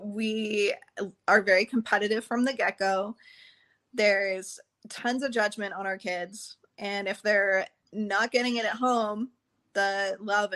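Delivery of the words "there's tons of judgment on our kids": ordinary delivery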